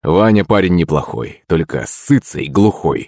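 plain reading voice

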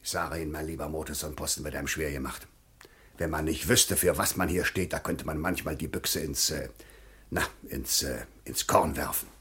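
weary muttered confidence to a colleague; confiding grumble. Acted. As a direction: grumble it under your breath. Official complains privately to a colleague.

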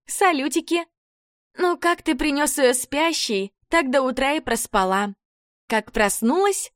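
put-on high bubbly girly chatter; chirpy gossip girl. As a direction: gossip brightly like a perky blonde.

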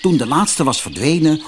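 plain reading voice

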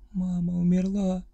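put-on high, slow tearful child's wail; sobbing child cry. A narrator's reading. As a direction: wail it through sobs like a boy. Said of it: "сквозь рыдание выкрикнул малыш".

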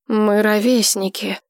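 ordinary delivery, nothing marked

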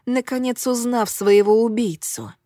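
plain reading voice

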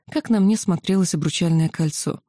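ordinary delivery, nothing marked

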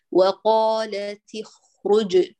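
slow وقالت اخرج with a clear throaty خ; careful kha sound. Shown as a demonstration. Quran teacher demonstrating the kha sound to a student, slow and exact. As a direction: stretch out اخرج so the خ scrapes audibly. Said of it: "سامعين صوت الخاء؟".

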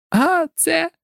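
put-on very high, girlish giggly voice. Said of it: "захихотіла Джінні". (хихотливо)

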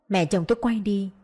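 plain reading voice

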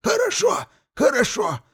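put-on high, gurgling old wizard. A narrator's reading. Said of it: "заклокотал мессир Архимаг".

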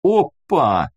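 ordinary delivery, nothing marked

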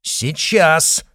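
sharp, loud single word; clipped out. Acted. Izotov answering the crowd, clipping the word out sharply. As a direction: rap it out firmly. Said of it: "отчеканил Изотов".

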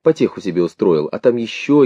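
ordinary delivery, nothing marked

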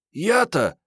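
put-on high girlish voice, taken aback; героиня книги переспрашивает. A narrator's reading. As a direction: toss the question back, pitched high. (высоким девичьим голосом, с вызовом)

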